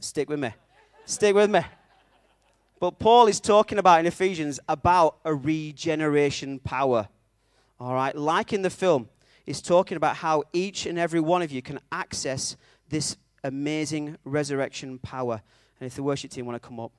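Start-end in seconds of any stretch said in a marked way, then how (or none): 1.0-1.6 high, very loud insistence; loud urging. Real, not acted. Preacher urging the congregation to follow, loud urging. Repeats the request more forcefully. 2.8-15.4 loud, emphatic sermon delivery; impassioned preaching. Real, not acted. Preacher linking the film to Paul's letter, impassioned preaching.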